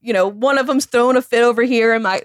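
ordinary talk, nothing marked

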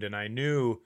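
ordinary talk, nothing marked

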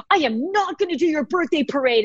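exasperated parent's warning, re-enacted; mock stern threat. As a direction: lay down the law, half-joking. Acting out her threat to the kids.

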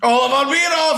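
high, loud triumphant yell; excited shout. Real, not acted. Quizmaster confirming the contestant's riddle answer, excited shout. Host cheers the solved riddle.

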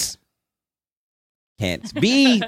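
high, very slow, with "be" swelling at the end; pause, then land high and long on "be"; stress on be. Shown as a demonstration. Co-host joins the emphasis game, stressing "be". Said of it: "a different word each time".

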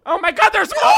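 high, loud cry of surprise; shocked exclamation. Real, not acted. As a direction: burst out as if caught off guard. Exclaims on discovering there is more.

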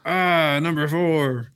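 lightly voiced sulking analyst; Buster Olney impersonation. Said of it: "That's your Buster Olney impersonation?".

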